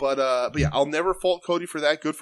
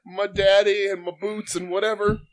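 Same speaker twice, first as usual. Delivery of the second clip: higher-pitched, whiny mocking imitation; mock whiny speech; 6 semitones higher, 3 dB louder than usual. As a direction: drone it out like a self-important, whiny promo. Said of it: "whiny speeches about what he thinks he is".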